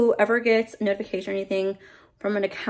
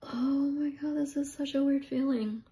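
high, soft, real upset; near tears; 5 semitones higher, 7 dB quieter than usual. Real, not acted. Vlogger taking in a singer's death, near tears.

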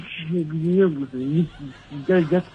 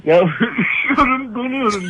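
high laughing cry of cold; laughing whine; 10 semitones higher, 5 dB louder than usual. Real, not acted. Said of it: "Bağırma, bağırma".

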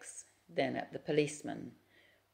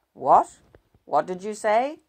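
1 shocked; 6 semitones higher, 10 dB louder than usual. A narrator's reading. Husband shocked by confession.

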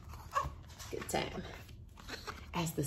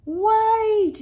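high loud shout of wait; yell; 13 semitones higher, 10 dB louder than usual. Acted. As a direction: call out to stop the train. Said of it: "yelled Country Carl".

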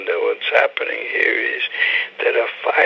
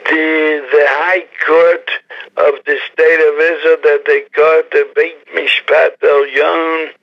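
ordinary talk, nothing marked

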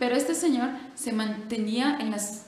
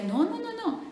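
high, playful no; mock-protesting friar; quick denial; 12 semitones higher than usual. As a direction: wave it off brightly. Friar brushes off monks.

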